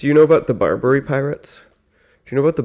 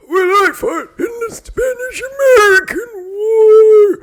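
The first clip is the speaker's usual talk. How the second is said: put-on very high, slow, wailing old-timer; hammed-up cry from a far older veteran; ancient veteran; 4 dB louder, slower than usual. Third veteran in the joke, high slow wail. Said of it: "the next is like".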